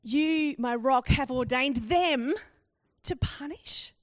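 loud stress on "them", drawn out, then "punish" lifted high; incredulous appeal, pausing before "to punish?"; emotional, stressed question. Shown as a demonstration. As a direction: lean hard on THEM, rise questioningly on PUNISH. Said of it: "There is so much emotion in what Habakkuk is asking".

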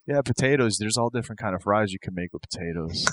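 low, quiet, absent-minded mumble; spaced-out weirdo. Retold or imitated. Wrestler listing fries out the car window, spaced-out murmur. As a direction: trail along softly as if to nobody. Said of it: "just kinda staring out the window, going".

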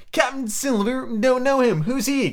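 high, dismissive call; crying out. A narrator's reading. Captain answering the mutineer, restrained cry. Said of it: "cried the captain".

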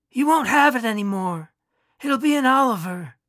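lightly played doctor; doctor's voice. A narrator's reading.

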